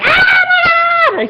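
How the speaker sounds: loud, very high squeal of delight; screaming fangirl. Retold or imitated. Fan reliving her scream on hearing Batman's voice, high loud excited shriek. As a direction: shriek it like a fan who just lost it. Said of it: "I screamed really, really loud".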